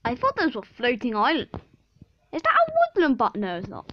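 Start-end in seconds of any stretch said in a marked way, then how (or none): 2.3-3.3 high excited squeal. Real, not acted. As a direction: jump up, thrilled. Excited spotting a biome.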